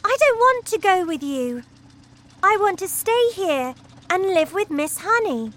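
put-on girl begging her parents to let her stay; pleading girl. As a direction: beg them to agree. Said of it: "Matilda cried".

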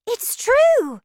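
high, loud exclamation; excited cry. A narrator's reading. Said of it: "Charlie cried".

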